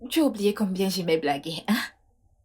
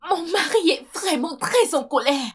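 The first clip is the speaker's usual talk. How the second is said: high, raised voice, very worked up; agitated distress; 6 semitones higher, 4 dB louder than usual. Acted. Wife warning her friend about her angry husband, agitated. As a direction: press the point urgently, upset.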